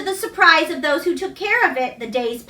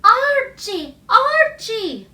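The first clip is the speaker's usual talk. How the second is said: higher, louder call, sweeter and clearer; 8 semitones higher, 3 dB louder, much slower than usual. A narrator's reading. The dream voice calling Archie again, sweeter and clearer. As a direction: sing the name out like a clear bell. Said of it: "sweeter and clearer than before".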